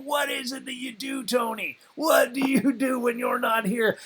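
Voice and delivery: high, straining to understand; earnest puzzled questioning. Retold or imitated. Young comedian quizzing his physicist roommate, exaggerated bafflement. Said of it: "trying my best to try and figure out".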